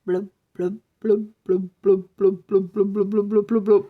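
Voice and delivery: finger flicking the lips, blub blub; lip blubbing. Acted. Zombie playing with her lips for the boys, blubbing. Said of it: "held her finger to her lips and went".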